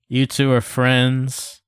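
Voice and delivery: ordinary talk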